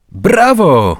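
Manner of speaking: loud, high cheer; a friend joining in the cheer; cheering bravo. A narrator's reading. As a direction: applaud the goal with your voice. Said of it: "zawtórował mu Zbyszek".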